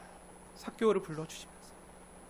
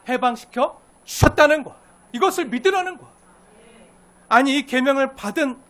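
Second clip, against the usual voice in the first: fervent preaching; 5 semitones higher, 12 dB louder than usual. Acted. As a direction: preach it out with full force. Preaching at full force.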